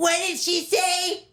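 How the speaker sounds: put-on high, puzzled kid voice; mock confused kids. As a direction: ask like a baffled schoolkid. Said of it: "They were like".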